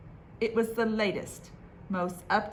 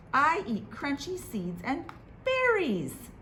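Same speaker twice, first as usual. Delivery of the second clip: mouse voice; 6 semitones higher than usual. Acted.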